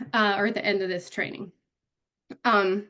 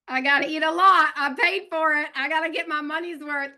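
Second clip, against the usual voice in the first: higher-pitched, insistent buffet-goer; mock buffet determination; 6 semitones higher, 3 dB louder than usual. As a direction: play up the need to get full value.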